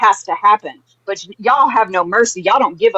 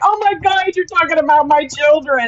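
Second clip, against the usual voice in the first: mocking quote; 6 semitones higher than usual. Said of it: "she's like".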